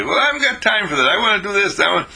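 fast, high, impatient brush-off; dismissive brothers. Acted. Brothers telling Jesus they have no time, fast high impatience. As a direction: rattle it off, no time for this.

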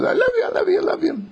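very high, squeaky mock-mother voice; imitated high-pitched gushing squeak. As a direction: squeal it like an overjoyed mom, hammed up. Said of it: "With her squeaky voice".

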